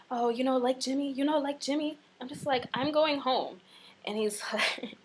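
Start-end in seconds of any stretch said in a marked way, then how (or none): 0.1-2.0 high, loud imitation of insistent suitor; mock coaxing. Retold man coaxing her. 2.7-3.6 high, raised-voice refusal; retold firm refusal. Retold refusal to insistent man.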